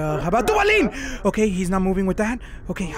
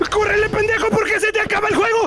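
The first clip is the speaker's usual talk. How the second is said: shouting; 11 semitones higher, faster than usual. Pretend. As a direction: yell it at them to run. Urging someone to flee Foxy.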